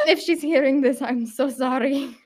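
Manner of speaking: affectionate put-on Romanian accent; Aunt Christy. Shown as a demonstration. Showing classmates how her aunt talks, put-on Romanian accent. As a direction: lay on a relative's Romanian accent, amused. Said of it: "I'll talk like my Aunt Christy".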